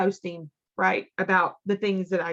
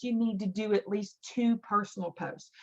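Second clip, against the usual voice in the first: ordinary talk, nothing marked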